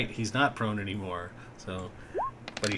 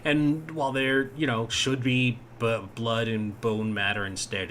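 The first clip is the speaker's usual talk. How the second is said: ordinary talk, nothing marked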